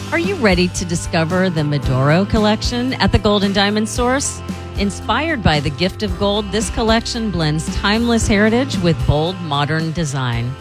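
smooth live ad read over music; luxury ad. Acted.

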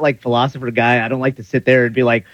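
normal talking voice

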